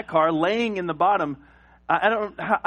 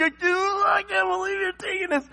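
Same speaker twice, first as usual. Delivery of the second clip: high, crying protest; a heartbroken kid who can't let go; much higher weepy shouting kid; tearful wail; 14 semitones higher than usual. Retold or imitated. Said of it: "I bawled".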